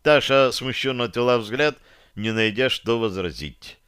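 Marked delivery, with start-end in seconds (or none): none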